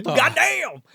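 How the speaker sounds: high-pitched mock holler, no real volume; mock scream. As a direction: imitate a preacher's outburst through laughter. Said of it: "He screams".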